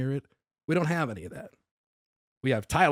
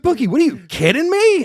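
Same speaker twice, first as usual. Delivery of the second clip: mock exasperation; 13 semitones higher, 9 dB louder than usual.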